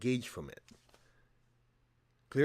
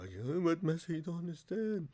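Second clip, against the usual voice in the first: a touch of pitiful whining, softer; mock pleading; 5 semitones higher, 5 dB quieter than usual. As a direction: beg for pity, half-hearted. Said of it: "putting myself lower and lower".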